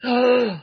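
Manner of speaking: high startled gasp; mock gasp. Churchgoer shocked at own foul words, gasping. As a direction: gasp as if caught cursing.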